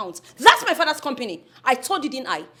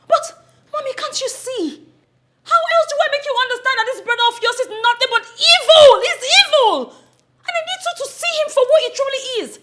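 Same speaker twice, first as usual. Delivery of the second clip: high, loud desperate plea; pleading shout; 11 semitones higher, 6 dB louder than usual. Acted. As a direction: cry out to make mom see. Daughter pleads against uncle.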